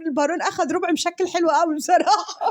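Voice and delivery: speaking through giggles, high; laughing. Acted. Delighted about the Baron's quarter, high with laughter. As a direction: giggle out the words.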